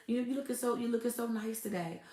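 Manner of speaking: smooth talker. Retold or imitated. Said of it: "He be like this".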